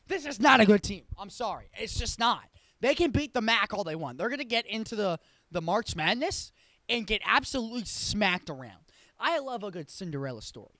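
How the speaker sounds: fed-up, punchy dismissal of a team; irritated. Real, not acted. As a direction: lay down each verdict with rising irritation. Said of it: "I'm getting irritated".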